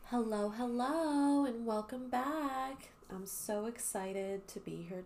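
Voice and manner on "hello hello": ordinary talk, nothing marked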